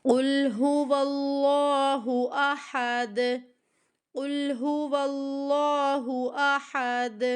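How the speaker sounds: very slow chanted قل هو اللہ with drawn vowels; Quran recitation. Shown as a demonstration. Teacher recites slowly so students read along. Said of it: "اب پڑھیں صحیح سے".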